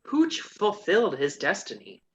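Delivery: usual speaking voice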